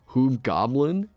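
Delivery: Hoob Goblin with a long oo in Hoob, goblin normal; half-wrong pronunciation. Shown as a demonstration. Streamer showing off a joke word, Hoob Goblin with a long oo up front. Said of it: "but not that".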